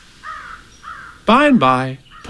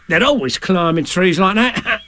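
higher, bouncy character voice; raised, blustery animal character; Tigger's voice; 8 semitones higher than usual. Acted. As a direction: bluster it like a confident braggart. Tigger boasts about climbing.

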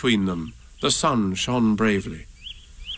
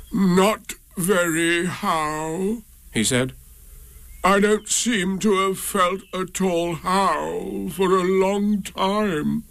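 slow, higher glum donkey voice; 6 semitones higher, slower than usual. A narrator's reading. Eeyore tells Pooh he feels poorly, slow and glum. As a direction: moan it out slowly and sorrowfully.

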